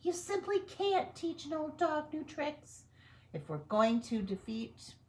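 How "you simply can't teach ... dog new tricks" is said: very high squeaky scientist voice; professor. Acted. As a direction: address the mice like a prim professor. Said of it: "said Professor O'Hare".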